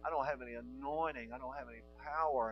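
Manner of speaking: mock timid excuse. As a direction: mimic a shy, whiny excuse-maker. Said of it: "don't ever come up to me again and say".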